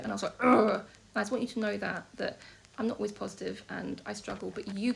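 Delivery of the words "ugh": loud, exasperated "ugh"; reluctant groan. Retold or imitated. Vlogger recalling not wanting to do it, exasperated groan. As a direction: groan out loud in dread of a chore. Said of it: "I didn't wanna do it".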